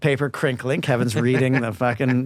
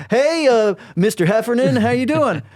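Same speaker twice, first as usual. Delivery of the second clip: high, louder star-struck fan voice; eager fan; 9 semitones higher, 4 dB louder than usual. Retold or imitated. Fan calling through the stall door, high and eager. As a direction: gush up at the stall like a thrilled fan.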